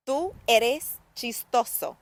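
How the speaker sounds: word by word, with clear gaps; careful teacher demonstration; slow model sentence. Shown as a demonstration.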